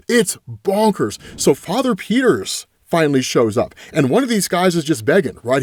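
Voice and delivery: ordinary talk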